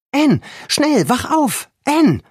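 high, loud urgent call to wake her friend. A narrator's reading. Girl spots a light and wakes her friend, loud urgent call. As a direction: yell her awake, urgent. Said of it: "rief sie".

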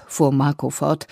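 ordinary delivery

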